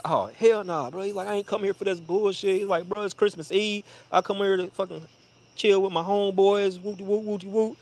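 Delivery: squeaky imitation of an offended friend; high mock Blake voice. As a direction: play Blake high and put-upon. Blake voiced protesting in story.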